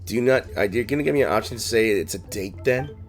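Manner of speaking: ordinary talk, nothing marked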